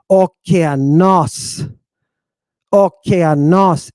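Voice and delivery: "okeanos" slow, with a long stressed "nos"; stress on last syllable. Shown as a demonstration. Teacher giving the proper pronunciation, final syllable stressed. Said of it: "The proper pronunciation is".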